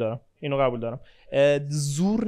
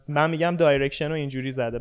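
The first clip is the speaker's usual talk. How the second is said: usual speaking voice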